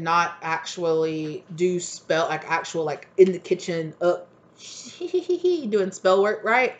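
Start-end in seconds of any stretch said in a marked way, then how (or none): 4.6-5.6 high-pitched mock witchy giggle; playful high hee-hee cackle. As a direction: cackle lightly over imaginary spell work. Mimics someone doing kitchen spell work.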